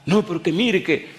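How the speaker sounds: high, insistent mock protest; protesting mother. Mother still trying to stop the pastor, flustered. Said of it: "a la mamá del muchacho".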